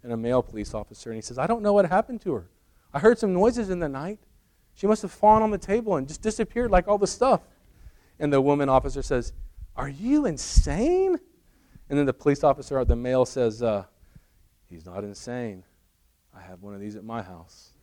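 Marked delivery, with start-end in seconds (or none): 1.4-7.4 high-pitched innocent bewilderment; mock-earnest, puzzled account; acted baffled husband. Husband feigns bafflement to police. 9.8-11.2 high, slow, drawn-out question; hammed-up female officer's disbelief; mock incredulity. Policewoman incredulous at husband. 14.7-17.7 low, soft confiding punchline; underplay it as the male officer; hushed, low admission to a colleague; acted deadpan. Policeman's punchline after her outburst.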